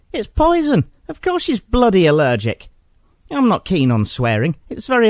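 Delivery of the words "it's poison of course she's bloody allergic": higher, exasperated snap; exasperated retort. Retold or imitated. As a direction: throw it back at the clueless clerk.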